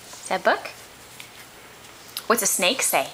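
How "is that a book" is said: ordinary talk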